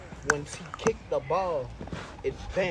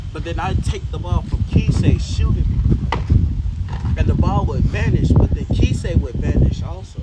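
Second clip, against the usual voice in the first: excited loud telling; 3 semitones higher, 12 dB louder than usual. Real, not acted. Excited climax of soccer tale.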